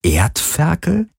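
slow, high, rising disbelief; incredulous mouthed question. A narrator's reading. Captain mouths the insult back at Galen, incredulous. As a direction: draw the word out into a puzzled question. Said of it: "wiederholten ihre Lippen stumm".

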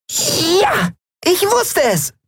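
very high, jubilant shout; joyful cry. A narrator's reading. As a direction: cheer with delight. (sehr hoch, jubelnd)

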